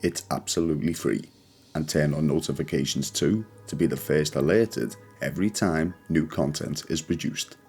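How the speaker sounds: plain reading voice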